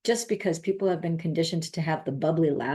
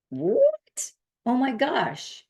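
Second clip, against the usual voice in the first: stunned disbelief; 8 semitones higher, much slower than usual. Retold or imitated. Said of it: "it was like".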